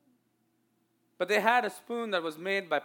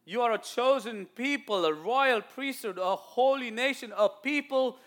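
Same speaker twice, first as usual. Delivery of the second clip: high, ringing scripture declaration; forceful preaching; 8 semitones higher, 9 dB louder, slower than usual. Real, not acted. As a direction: proclaim it to the church, drawing out the final words.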